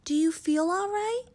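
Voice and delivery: lightly voiced anxious mother; mother checks her daughter's health; worried mother. A narrator's reading. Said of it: "she asked".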